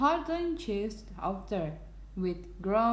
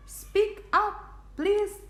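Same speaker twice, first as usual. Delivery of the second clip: high, louder plea; calling out; 12 semitones higher, 4 dB louder than usual. A narrator's reading. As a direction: raise the voice to be heard. Elephant calls to a faint voice.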